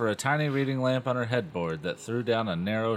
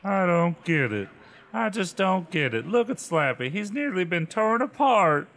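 upset; 8 semitones higher, 5 dB louder than usual. Acted. Upset sister over dummy.

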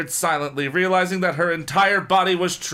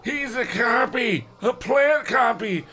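slow, higher shouted warning; 9 semitones higher, slower than usual. Acted. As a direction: shout that he's a copy.

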